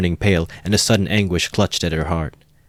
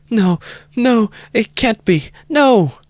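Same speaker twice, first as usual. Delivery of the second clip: put-on high, louder cry of a desperate young woman; 5 dB louder, slower than usual. A narrator's reading. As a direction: cry out as if stabbed, pleading. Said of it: "Sonia cried aloud in desperation".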